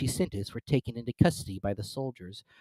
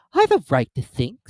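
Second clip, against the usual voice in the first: higher, louder curt retort; sharp; 7 semitones higher, 9 dB louder than usual. A narrator's reading. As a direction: snap back defensively. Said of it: "said Alice sharply".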